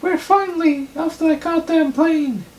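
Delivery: very high, loud falsetto character voice; piercingly high cartoonish put-on voice; Anthony Falcon. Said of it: "Anthony Falcon".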